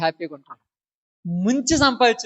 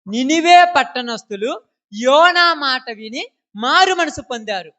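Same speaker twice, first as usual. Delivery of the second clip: loud, high, slow and stretched; scripture declamation; 3 semitones higher, 5 dB louder, slower than usual. Acted. Preacher tells the congregation of Nineveh's repentance, loudly declaimed. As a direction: proclaim it with long swelling phrases.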